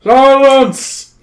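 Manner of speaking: loud, high, drawn-out command; bellowing. A narrator's reading. King silences his snorting subjects, full bellow. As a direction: bellow it to cut off a crowd. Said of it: "the king bellows".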